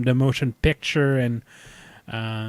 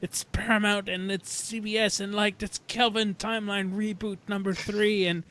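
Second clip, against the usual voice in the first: high, mocking fan voice, higher pitch; mock fan rant; 6 semitones higher than usual. Mocking angry fans picturing the Paramount lot, in a high mock-fan voice. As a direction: sneer like an outraged fan. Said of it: "They're gonna".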